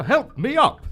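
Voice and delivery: high, loud plea for help; desperate cry. Acted. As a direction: Leopold hanging from the ledge, loud desperate cry. Hanging from the ledge.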